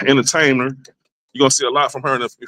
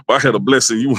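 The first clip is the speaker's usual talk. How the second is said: usual speaking voice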